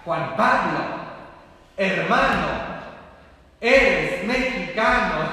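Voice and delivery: loud, high, stretched-out chant; imitated crowd shout. Priest imitates youths greeting the late Pope, loud chanted shout. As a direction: shout it like young people cheering. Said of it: "le empezaron a gritar".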